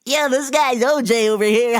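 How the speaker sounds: high, loud, laughing mock of the old man; mocking customer. Old customer telling the boss about O J, high mocking. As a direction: crow it like he's proud of the joke. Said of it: "quoting the customer again".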